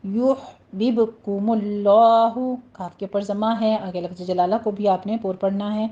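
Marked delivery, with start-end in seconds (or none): 0.0-2.4 slow یحببکم اللہ with heavy full Allah; slow teacher recitation of یحببکم اللہ; tajweed demo. Shown as a demonstration. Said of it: "بب کے اوپر قلقلہ کرنا ہے".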